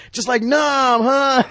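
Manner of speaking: high, loud, hammed-up gung-ho holler; mock war flashback. Davi's agent relives Nam in the helicopter, mock gung-ho holler. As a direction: whoop it like a veteran reliving combat. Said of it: "having a Nam flashback".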